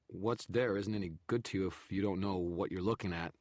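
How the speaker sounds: nervous haggling; bargaining photographer. A narrator's reading. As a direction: press for a trade. Narrator voices photographer.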